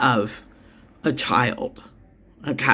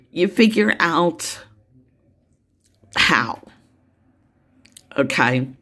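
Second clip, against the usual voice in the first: teary. Real, not acted. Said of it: "I apologize for getting teary here".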